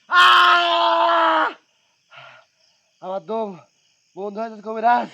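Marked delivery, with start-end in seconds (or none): 0.1-1.5 loud pained cry out; pained jinn cry. Acted. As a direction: cry out as if burned. Jinn cries out in pain.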